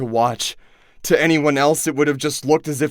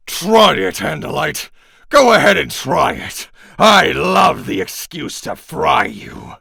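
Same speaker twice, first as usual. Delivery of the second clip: louder, higher menacing taunt; taunting threat; 5 semitones higher, 5 dB louder than usual. Acted. Chapman taunts the trapped Andalite cat, menacing. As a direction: dare him to move, relishing it.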